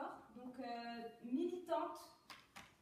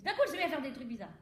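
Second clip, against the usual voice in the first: bold commitment; 2 semitones higher, 10 dB louder than usual. Shown as a demonstration. Coach showing an actress how to own it, bold and loud.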